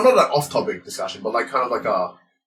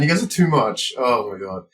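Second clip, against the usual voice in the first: ordinary delivery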